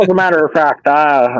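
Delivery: normal talking voice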